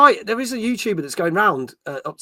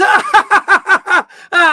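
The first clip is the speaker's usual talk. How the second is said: high, loud staccato "ah" laugh; imitated laughter; 13 semitones higher, 9 dB louder, slower than usual. Retelling a YouTuber's joke prank, mock laughing. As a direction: mimic a loud burst of laughter.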